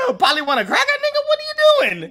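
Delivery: high, fast, rising disbelief; shocked outburst. Retold or imitated. Acting out shock at bird.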